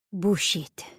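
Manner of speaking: put-on dry French-accented "Bullshit". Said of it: "Her French accent makes the words".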